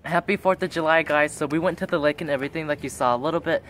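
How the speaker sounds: usual speaking voice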